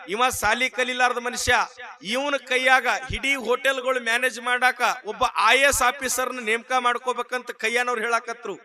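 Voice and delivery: usual speaking voice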